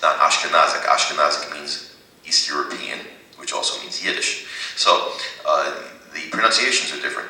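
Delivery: ordinary talk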